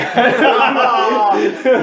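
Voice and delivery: bursting into loud high laughter. Real, not acted. Friend cracking up loud at his own cuffed-buddy joke, laughing hard.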